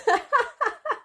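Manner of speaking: a very high, loud peal of laughter; squealing laugh. Real, not acted. Painter cracking up at her own story, squealing laugh. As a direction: howl with laughter.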